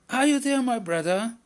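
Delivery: high, loud call across the river; calling out loudly. A narrator's reading. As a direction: call to a friend far off. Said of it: "Squirrel called out loudly".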